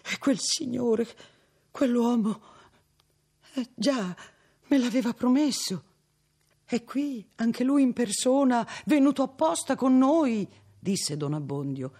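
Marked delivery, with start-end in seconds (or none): 0.0-10.5 put-on high, loud flustered old priest voice; country priest calming the girl, high and flustered; fussy old priest reassuring. A narrator's reading. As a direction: reassure her, flustered and eager.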